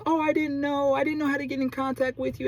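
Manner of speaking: high-pitched mock innocent neighbor; lying neighbor. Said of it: "making all of these, like, lies".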